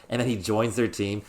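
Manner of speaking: ordinary talk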